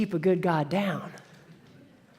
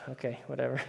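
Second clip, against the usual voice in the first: exhausted giving up; 7 semitones lower, 12 dB quieter, much faster than usual. Retold or imitated. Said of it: "they're like".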